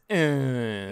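long droning whine; whiny robot groan. Acted. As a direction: mimic the robot's whine as his name. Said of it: "I just remember him as".

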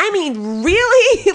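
high, loud disbelief; appalled, high-pitched outburst; incredulous. Real, not acted. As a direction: push it up high in exasperated disbelief. Disbelief at the machines sent.

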